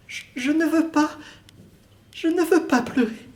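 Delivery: high woman holding back tears; tearful woman's voice. Acted. Woman's part, fighting tears.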